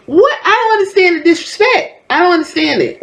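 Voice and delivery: high-pitched, incredulous protest; real bewilderment at the disrespect, voice high; baffled indignation. Real, not acted.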